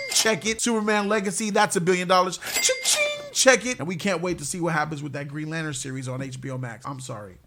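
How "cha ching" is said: high, ringing imitated till sound; cash register cha-ching. Predicting a billion-dollar movie, high ringing cha-ching.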